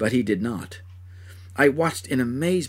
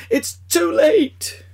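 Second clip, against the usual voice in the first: much higher, louder, slow wail; drawn-out agonized wail; 7 dB louder, much slower than usual. Acted. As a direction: stretch the scream out in despair. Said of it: "Screaming in agony".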